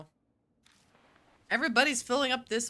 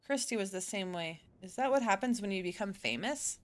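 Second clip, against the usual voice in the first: normal talking voice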